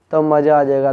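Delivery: normal talking voice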